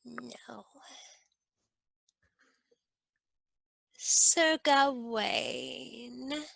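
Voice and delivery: put-on a strange old hag's voice, slow with a cackle and whispers; Dame Ragnell. Dame Ragnell slyly naming her reward before the court. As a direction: savour every word like a sly crone.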